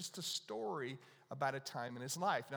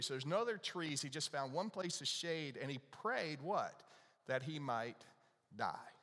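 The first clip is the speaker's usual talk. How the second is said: ordinary delivery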